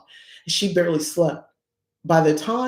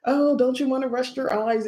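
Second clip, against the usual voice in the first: high, cajoling quote; mock coaxing; 10 semitones higher than usual. Family tempting a night worker to sleep, mock coaxing. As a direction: imitate relatives coaxing in a high voice.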